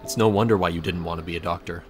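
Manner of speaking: usual speaking voice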